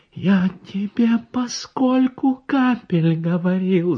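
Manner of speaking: high, slow and choked; doctor choking out a reproach to the woman; strangled little voice. A narrator's reading. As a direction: squeeze the words out in shock. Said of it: "задушенным голоском заговорил я".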